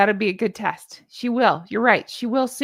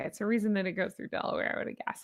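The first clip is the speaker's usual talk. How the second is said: ordinary talk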